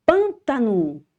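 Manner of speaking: Pântano said high with a closed nasal pân; a clear model of Pântano for the class; teacher's model word. Shown as a demonstration. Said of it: "vai dar alguns exemplos".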